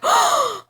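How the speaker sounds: high, sharp mock gasp; shocked gasp. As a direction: act out a sudden gasp of realization. Acts out her shock on realizing.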